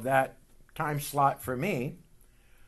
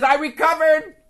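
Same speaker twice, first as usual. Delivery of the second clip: higher, louder triumphant brother; triumphant report; 13 semitones higher, 11 dB louder than usual. Retold or imitated. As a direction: proclaim it as a victory.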